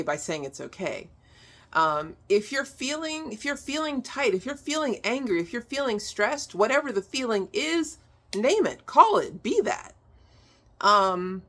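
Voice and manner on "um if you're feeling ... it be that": lifted, insistent run of if-clauses; building emphasis up to be that; emphatic urging. Real, not acted. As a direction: push each feeling word higher. Urging listeners to name feelings.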